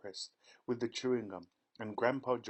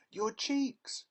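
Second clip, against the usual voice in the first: much higher, louder scream; 12 semitones higher, 4 dB louder than usual. A narrator's reading. Mother watching daughter turn blue, scream. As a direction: cry out in panic. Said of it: "screamed Missus Beauregard".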